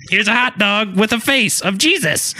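high-pitched silly headline read; mock tabloid headline. Mocking today's newspaper to co-hosts, mock headline. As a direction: announce absurd news in a high voice. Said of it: "nowadays it's like".

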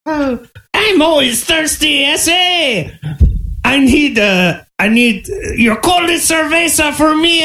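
a manic cryptid shouting for tequila; wild Chupacabra. Acted. Chupacabra demanding tequila and tacos from the bartender, high manic yell.

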